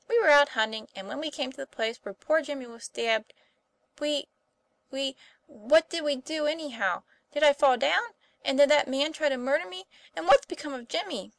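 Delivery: high, loud, urgent questions; confused recollection. A narrator's reading. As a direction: falter on "we", then tumble into anxious questions. Tom struggles to remember, acted.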